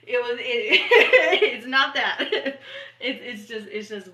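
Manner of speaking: breaking into real laughter; giggling mid-sentence; laughing. Real, not acted. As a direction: laugh through the words. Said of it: "ah, they're so deep right now".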